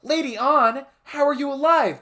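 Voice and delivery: very high, loud yell; shocked cat yell. Acted. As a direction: shriek it in shock. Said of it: "Morgana yelled".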